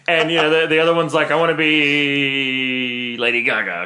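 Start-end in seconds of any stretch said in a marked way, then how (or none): 1.3-3.8 nun voice. Acted.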